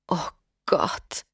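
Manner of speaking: breathless hushed gasp of shock; shocked whisper. A narrator's reading. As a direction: whisper it in horror. Schock, geflüstert. (erschrocken geflüstert)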